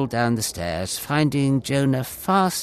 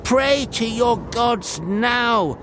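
high, slow desperate cry to the crew; sailor crying out; 12 semitones higher, 4 dB louder, slower than usual. A narrator's reading. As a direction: plead loudly and slowly, afraid. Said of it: "one sailor cried".

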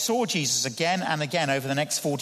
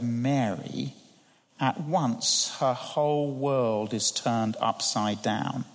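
normal talking voice

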